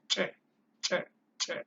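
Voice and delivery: short, sharp, explosive "Che". Shown as a demonstration. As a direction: pop each "che" out hard and short. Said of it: "che is voiced or explosive".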